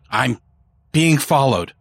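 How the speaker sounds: hesitate, then confess it nervously; quavering. A narrator's reading. Hunted man confiding in a priest, quavering. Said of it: "voice quavering".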